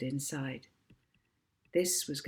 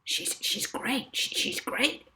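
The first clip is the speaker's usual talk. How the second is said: breathy half-whispered insistence; Barry pleading, hushed and keen; insisting Barry; 7 semitones higher than usual. Acted. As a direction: stammer it out, pressing the point. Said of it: "insisted Barry".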